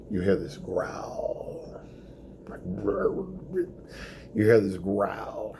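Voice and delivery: slow, hushed ghost-story whisper; spooky whisper. Acted. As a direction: draw them in like a campfire tale. Spooky buildup to stomach growl.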